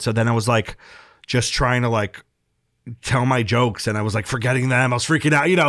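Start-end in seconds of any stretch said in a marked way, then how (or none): none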